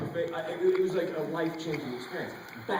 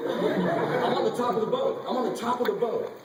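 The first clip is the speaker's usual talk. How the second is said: laughing; 7 semitones higher, 5 dB louder than usual. Real, not acted. Laughing recalling climbing boat.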